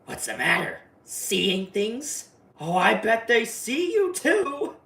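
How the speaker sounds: mocking clown voice climbing into a sing-song; Pennywise voice. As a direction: taunt like a killer clown, ending high and slow. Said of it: "Pennywise was like".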